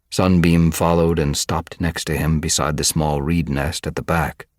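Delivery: ordinary delivery, nothing marked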